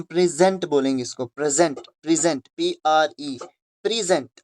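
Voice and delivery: प्रेजेंट said as prezent, stress on the first syllable, rhyming with pleasant; pronunciation drill for students; pronunciation demo. Shown as a demonstration. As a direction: model प्रेजेंट clearly with the stress on pre. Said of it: "प्लीजेंट की तरह निकलती है".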